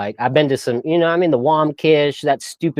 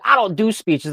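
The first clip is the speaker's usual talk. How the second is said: higher-pitched copy of a stubborn refusal; copycat refusal; 8 semitones higher than usual. Retold or imitated. Classmates copying the speaker's refusal, mock defiant. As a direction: mimic the defiant kid, pitched up. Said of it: "try to copy me and be like".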